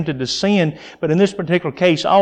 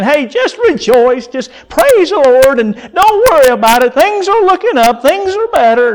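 mock-cheery and much higher, brushing the pain aside; breezy fake comfort, much higher up; sarcastic; 10 semitones higher, 4 dB louder than usual. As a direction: chirp it like hollow good news. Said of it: "He's not speaking sarcastically and saying".

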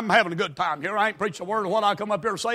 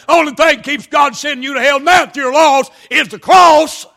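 loud, high shouted preaching; full-force preaching; 6 semitones higher, 9 dB louder than usual. Acted. Preacher shouting hell at the church. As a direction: thunder it at the congregation.

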